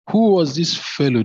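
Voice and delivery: the king insists on knowing who; demanding. Acted. King demanding answers from returning messengers, sharply. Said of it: "the king demanded".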